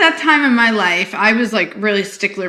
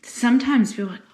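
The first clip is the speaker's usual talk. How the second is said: ordinary delivery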